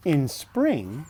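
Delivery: bright, lifted storybook voice; reading to children. Acted. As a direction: read it out like a bedtime book. Reading picture book aloud.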